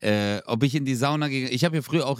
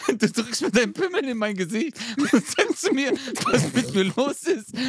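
half laughing, half indignant; laughing outraged retort; 10 semitones higher than usual. Retold or imitated. Retelling how he told off the sauna guy, laughing high outrage. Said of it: "Ich so".

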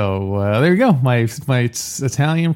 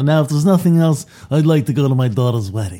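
Don Corleone; faster than usual. Retold or imitated. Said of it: "Don Corleone saying".

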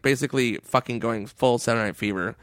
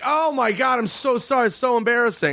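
mock apology; 10 semitones higher than usual.

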